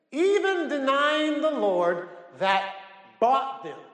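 high, slow declamatory Bible reading; preached scripture reading. Acted. Preacher reading scripture to the congregation, high, slow proclamation.

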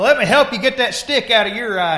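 high, loud offer of help; acted parable character. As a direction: call it out like a busybody brother. Acts out man in parable.

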